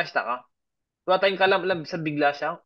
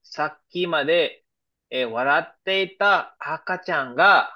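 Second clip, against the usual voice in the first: slow, deliberate reading of the example sentence; slower than usual. Shown as a demonstration. Teacher reading an example sentence to students, slow and careful. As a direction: spell it out slowly for the class. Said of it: "Yeah, example number one".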